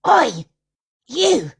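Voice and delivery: squawky high parrot shout, fuck off; loud, high bird cry of a rude parrot; high squawking parrot yelling. Retold or imitated. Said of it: "the old parrot turns around and goes".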